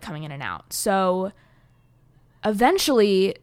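ordinary delivery

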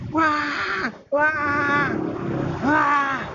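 high muffled yell into cloth; muffled scream. Retold or imitated. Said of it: "gue teriak, tapi gue tutupin muka gue". (teriakan teredam kain)

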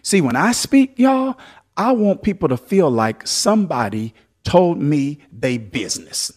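ordinary delivery

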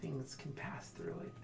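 slow eerie seer voice; a hint of a spooky oracle; creepy oracle voice. GM voicing oracle figure.

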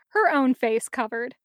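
plain reading voice

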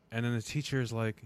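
usual speaking voice

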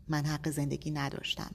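usual speaking voice

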